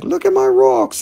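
squeaky, high nerdy plea; silly high voice. Retold or imitated. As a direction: pipe up, eager and ignored. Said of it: "in the background being like".

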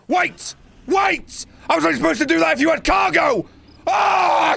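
desperate to undo it; panicked shout. Real, not acted. Realizes mistake, panics.

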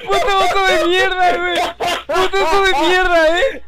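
loud raging shout, half laughing; furious yelling. Real, not acted. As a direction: rage at the screen, yelling. Raging at the game.

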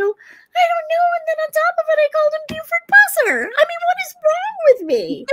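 very high comic wail of bafflement; frantic confession. Retold or imitated. Wife confesses to husband what she shouted, wailing in exasperation. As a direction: squeal it out in mock despair.

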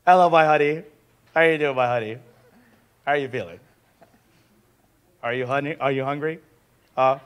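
soft, low, tender coaxing; cherishing husband. Acted. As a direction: coo it gently, like doting on someone dear. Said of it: "This is what love looks like and cherish".

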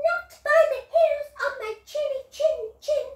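high, fast piggy squeak; squeaky little pig. Acted. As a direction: rattle it off in a tiny pig voice. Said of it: "said the first little pig".

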